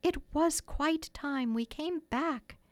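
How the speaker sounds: understated guilty realisation; twinge of remorse. A narrator's reading. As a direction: admit it slowly, ruefully. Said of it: "first real twinge of remorse".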